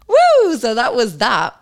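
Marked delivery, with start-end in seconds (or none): 0.1-0.6 loud, very high woo; short celebratory squeal; whoop. Real, not acted. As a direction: let out a piercing little whoop. Cheer after finishing the article.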